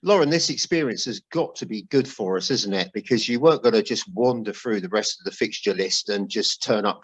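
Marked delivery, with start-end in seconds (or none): none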